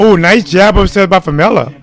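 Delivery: normal talking voice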